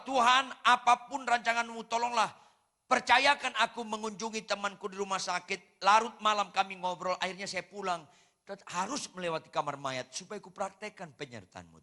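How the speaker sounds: loud, high pleading prayer; hammed-up panicky plea; frightened man praying. Preacher voicing a coward's prayer by the morgue, loud pleading. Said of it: "Orang penakut berdoa".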